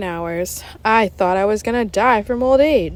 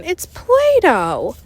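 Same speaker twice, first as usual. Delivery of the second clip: slow, loud, higher disgusted shout; 8 semitones higher, 4 dB louder, much slower than usual. Acted. Ryan telling the teacher the gum is clay, loud and slow. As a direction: drag out the disgust. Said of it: "shouted Ryan".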